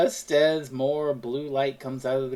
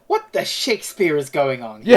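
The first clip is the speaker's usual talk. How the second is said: louder startled exclamation; exclaiming Dickens; 2 semitones lower, 8 dB louder than usual. Retold or imitated. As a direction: blurt it out in shock. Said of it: "He's like".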